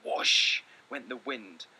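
normal talking voice

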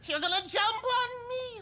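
high, panicky wail; terrified. Acted. Cowering accountant fears the man will jump on him, terrified. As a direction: wail as if about to be attacked.

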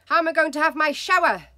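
high, loud, fast demand. Acted. Husband demanding of wife about shower, loud and fast. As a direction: insist on an answer, rushed. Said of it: "he demanded".

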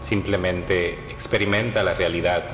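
measured meditation voice with long pauses; guided meditation. Acted. Yoga teacher leading listeners through Yoga Nidra, guided meditation. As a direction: guide them phrase by phrase, leaving long silences between.

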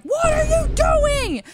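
imitated angry New York driver shouting; road rage yell. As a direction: yell out the car window at another driver. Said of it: "yelling at us through his window".

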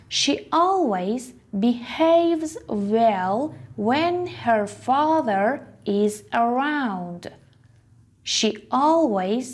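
slow, deliberate reading; very slow model sentence. Shown as a demonstration. Teacher reading an example sentence very slowly. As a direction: stretch each word out for learners. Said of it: "model sentence read slowly".

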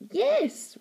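high-pitched mimicry of a child's cry; mocking. A narrator's reading. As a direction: throw it back in a mocking squeal. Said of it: "mocking her niece's tone".

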